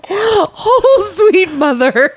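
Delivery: high, slow, breathless after laughter; after laughing hard. Real, not acted. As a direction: still reeling from a laughing fit. Still laughing at screen name.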